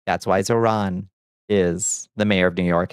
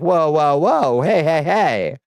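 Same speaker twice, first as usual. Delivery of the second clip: high, louder mock protest; mock alarm; 6 semitones higher, 4 dB louder, slower than usual. Democrats balking at a candidate to their left, mock alarm. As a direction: halt everyone with pitched-up, put-on objection. Acts Democrats suddenly objecting.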